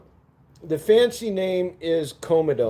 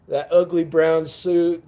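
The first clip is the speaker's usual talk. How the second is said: ordinary delivery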